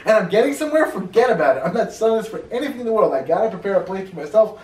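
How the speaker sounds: high, loud, emphatic retold refusal; acted adamant refusal. Grave owner refusing to sell his plot, acted adamant refusal.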